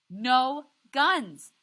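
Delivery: wife yelling at her husband, tearful and furious. A narrator's reading. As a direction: scream it through tears at your husband. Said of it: "Graham says".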